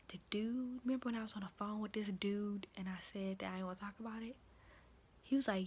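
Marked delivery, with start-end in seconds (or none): 0.1-4.4 hushed whisper so the man can't hear. Retold or imitated. Whispering to a coworker about the man nearby. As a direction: breathe the words out low and secret. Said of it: "I was whispering it just like this".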